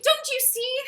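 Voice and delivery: loud, high-pitched plea; desperate outburst. Acted. Desperate to make a partner understand, loud outburst. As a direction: cry it out in exasperation. First take of desperate appeal.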